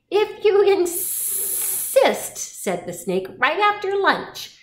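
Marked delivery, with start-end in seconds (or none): 0.1-2.4 very high, slow, stretched-out S; sinuous storybook snake voice. A narrator's reading. 3.4-4.6 high-pitched storybook snake; high, teasing creature voice. A narrator's reading. Snake finishes its reply.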